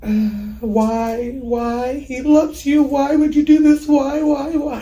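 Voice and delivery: loud, high anguished pleading; retold screaming. Mother to son who killed her boyfriend, retold anguished screaming. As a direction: relive the screamed plea, voice climbing to a peak. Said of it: "I just remember screaming".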